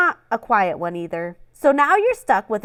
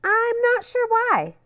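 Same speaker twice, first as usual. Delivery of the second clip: high, slow, playfully clueless; mock innocent puzzlement; 12 semitones higher than usual. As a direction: act like you truly have no idea. Taylor feigns puzzlement.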